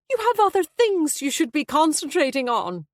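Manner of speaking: high, loud petulant nagging. A narrator's reading. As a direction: scold the son petulantly. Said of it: "Abby sounded petulant".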